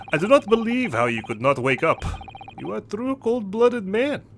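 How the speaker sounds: put-on stern sailor in a Russian accent. Sailor accusing the handcuffed hero, in a Russian accent. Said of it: "Sorry for my abrupt accent change".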